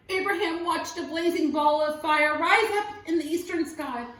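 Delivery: plain reading voice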